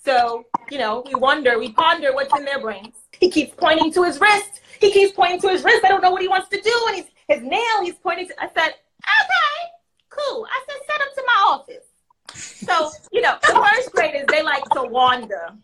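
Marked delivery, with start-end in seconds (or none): 3.2-8.3 worried teacher. Retold or imitated. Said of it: "phone call from one of my teachers". 9.0-11.8 breezy counselor. Retold or imitated. Counselor answers the worried teacher.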